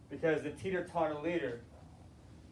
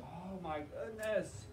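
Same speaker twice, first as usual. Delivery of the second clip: weary sigh; 11 dB quieter, much slower than usual. Retold or imitated. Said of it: "He's like".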